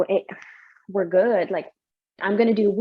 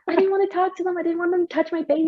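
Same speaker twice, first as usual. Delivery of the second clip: anxious, pitched up; 9 semitones higher than usual. Retold or imitated. Retold anxiety, pitch high.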